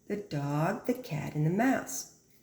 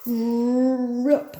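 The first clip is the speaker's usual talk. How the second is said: loud sound word; 6 semitones higher, 8 dB louder than usual. Acted. Reading to the class, a loud high Rip!. Potato rips out of ground.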